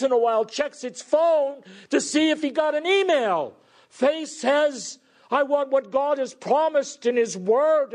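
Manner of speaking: high, impassioned preacher's voice; full-force preaching. Acted. Preacher rousing the congregation about active faith, full-force preaching.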